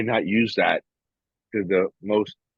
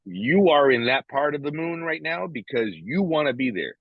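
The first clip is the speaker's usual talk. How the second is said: higher-pitched retold lecture; retold firm telling-off; 7 semitones higher than usual. Man retelling what he told his wife, firm. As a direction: lay it out to her, pitched up. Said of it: "You know, I said".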